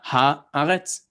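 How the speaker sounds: ha'aretz stressed at the front, on HA; stress on wrong syllable. Shown as a demonstration. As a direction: say ha'aretz drawn out, punching the opening HA. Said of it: "telling you which syllable you accent".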